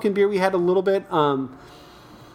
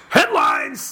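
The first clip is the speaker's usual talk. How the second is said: dramatic announcer; 11 semitones higher than usual. Pretend. Dramatic segment intro.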